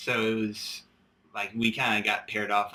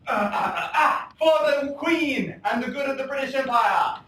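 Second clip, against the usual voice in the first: heroic pirate; 5 dB louder than usual. Acted. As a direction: declaim it like a swashbuckling hero. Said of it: "The good guy goes".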